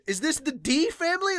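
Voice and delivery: ordinary delivery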